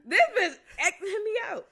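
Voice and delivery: laughing disbelief. Real, not acted. Laughing at being hung up.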